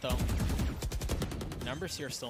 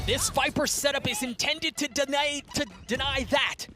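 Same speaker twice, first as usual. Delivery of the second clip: esports caster; 11 semitones higher, 7 dB louder than usual. Real, not acted. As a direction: hype play-by-play, climbing higher and louder. Live play-by-play building to hype.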